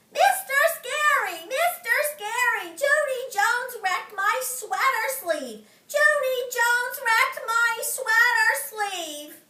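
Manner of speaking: high, loud tattling yell; hollering. Acted. May hollering to Mister Scary that Junie wrecked her sleeve, loud and high. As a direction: shout it across the room to the teacher. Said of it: "hollered at the top of her lungs".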